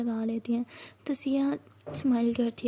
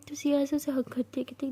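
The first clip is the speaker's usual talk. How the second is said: ordinary delivery, nothing marked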